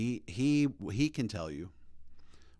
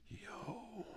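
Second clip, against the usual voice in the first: high, very soft mock-startled "yo"; hushed amazement; 14 semitones higher, 16 dB quieter than usual. Himself spotting a Sikh at the airport, hushed astonishment. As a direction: imitate a stunned reaction under the breath.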